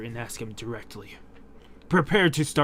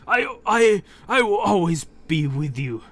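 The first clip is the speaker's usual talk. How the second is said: dying grandfather; 3 semitones higher, 5 dB louder than usual. Acted. Gramps's dying words.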